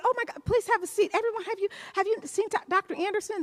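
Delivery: gushing. Retold or imitated. Usher showing the doctor off to guests, gushing. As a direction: fuss over an honoured guest in a put-on high voice.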